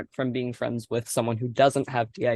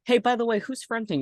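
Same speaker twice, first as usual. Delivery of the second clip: pitched-up friendly challenge; high questioning quote; 10 semitones higher than usual. Retold or imitated. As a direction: jump up high, curious and surprised. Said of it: "was like".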